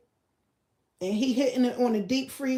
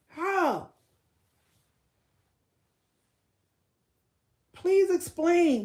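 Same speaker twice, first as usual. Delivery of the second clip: mock demand; 8 semitones higher, 3 dB louder, much slower than usual. Mock questioning an imagined suitor.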